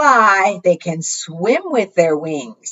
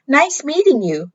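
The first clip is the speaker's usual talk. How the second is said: little Pip; 7 semitones higher than usual. A narrator's reading. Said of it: "said Pip".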